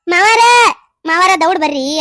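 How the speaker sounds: loud urgent call; daughter-in-law calling father-in-law to come quickly. Acted. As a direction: shout for help.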